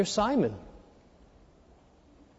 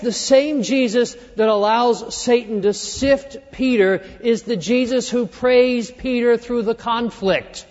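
ordinary talk, nothing marked

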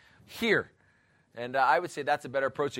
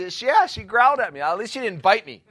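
laughing; 5 semitones higher, 6 dB louder than usual. Real, not acted. Laughing retelling of growling girl.

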